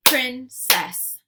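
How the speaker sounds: Princess as loud "prin... cess"; split into syllables. Shown as a demonstration. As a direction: punch out both halves of Princess separately. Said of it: "give you two parts to a word".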